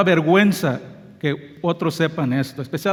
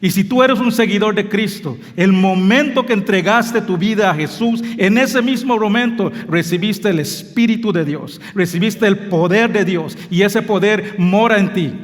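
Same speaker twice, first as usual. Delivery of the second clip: raised, loud exhortation; fervent preaching; 4 semitones higher, 4 dB louder than usual. Acted. Pastor urging the congregation, fervent preaching. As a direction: call it out to the believers.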